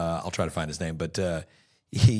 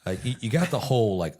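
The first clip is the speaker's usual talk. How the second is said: ordinary talk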